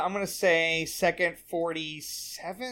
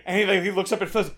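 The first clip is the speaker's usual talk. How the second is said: normal talking voice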